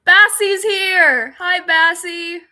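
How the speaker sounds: loud, high, slow welcome call; excited greeting. Real, not acted. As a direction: call out to a friend who just joined, thrilled. Greeting a friend joining.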